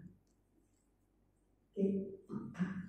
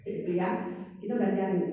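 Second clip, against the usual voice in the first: ordinary talk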